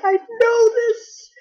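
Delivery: high, loud, drawn-out exclamation; excited recognition. Retold or imitated. Recalling seeing the quiz question, excited recognition. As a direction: re-enact a gleeful burst of knowing it, stretched out.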